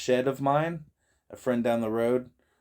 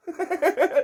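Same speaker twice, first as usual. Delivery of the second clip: laughing; 3 dB louder than usual. Real, not acted. Laughing at church story.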